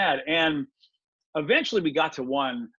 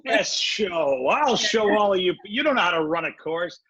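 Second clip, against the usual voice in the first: mock indignant; 5 dB louder, slower than usual. Threatening to complain to organizers.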